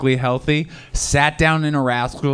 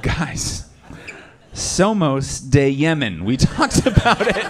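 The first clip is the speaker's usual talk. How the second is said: slow, higher, exasperated Spanish reply; mock exasperated student; 4 semitones higher, slower than usual. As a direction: playfully scold them for forgetting the lesson. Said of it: "It's like".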